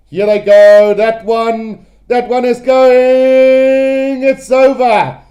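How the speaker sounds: big, drawn-out commentator's call; excited goal call. Real, not acted. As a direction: stretch out GOING as the kick sails over. Calling the decisive kick at goal.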